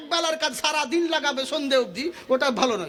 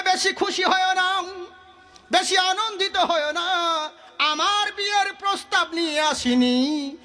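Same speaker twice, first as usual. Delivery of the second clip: higher chant with drawn-out endings; intoned admonition; 6 semitones higher than usual. Acted. Prophet cautioning the delighted guardian, melodic high chant. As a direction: sing out each caution separately.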